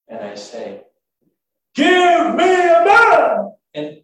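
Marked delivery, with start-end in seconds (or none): 1.8-3.5 huge high drawn-out bellow; full-out yell. Acted. Goliath calls his challenge from the riverbank, yelling. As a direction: yell it slowly, as loud as you can. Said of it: "yell it out as loud as I can".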